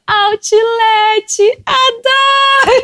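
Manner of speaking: high, drawn-out yell, imitating an ad; berro de comercial. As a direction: belt out the slogan in a shrill mock scream. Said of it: "entrava eu berrando, berrando. Eu gritava". (berrando o bordão, bem agudo e esticado)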